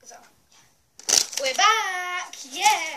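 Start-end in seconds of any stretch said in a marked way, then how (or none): 1.4-3.0 loud, bright welcome back; excited shout. Real, not acted. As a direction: burst back in cheering. Returning to camera, excited.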